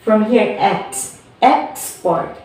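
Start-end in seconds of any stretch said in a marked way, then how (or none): 1.4-2.4 "export" stressed on EX, falling away; first-syllable stress. Shown as a demonstration. Teacher repeating the example, "export" stressed on its first syllable. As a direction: put the weight on EX in "export". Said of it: "we stress here from X".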